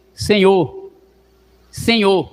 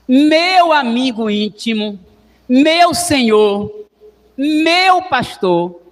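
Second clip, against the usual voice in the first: higher, louder, slow devotional phrasing; fervent; 4 semitones higher, 3 dB louder, much slower than usual. Acted. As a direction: savor each title of God. Fervent psalm translation.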